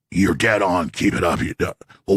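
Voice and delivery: low, fading voice of a dying relative; lightly voiced deathbed whisper; dying words. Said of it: "[name] doing an impression of a dying relative".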